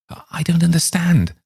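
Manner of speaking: high, sighing despair. A narrator's reading. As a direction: sigh it out helplessly. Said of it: "sighed Oak".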